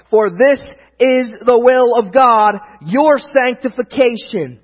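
loud, high, slow preacher's climax; scripture proclamation. Real, not acted. Preacher quoting God's will to the church, forceful proclamation. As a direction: proclaim every word at full force. Climactic quote, later re-read plainly.

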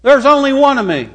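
high, loud voice of God laying down the law; God commanding. Acted. God giving the first commandment to the people, commanding. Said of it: "he says".

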